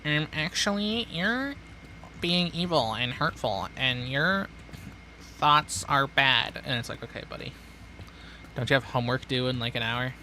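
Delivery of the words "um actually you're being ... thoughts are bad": high, whiny mock of a preachy kid; high mocking nerd voice; nasal nerd voice mock. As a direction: sneer it like a smug internet scold. Mocking a whiny online critic.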